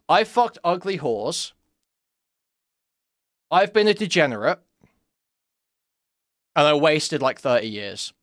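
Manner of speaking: lightly voiced old alcoholic bragging; mock old drunk. Mocking old alcoholic men who brag about life experience. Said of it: "what that means is".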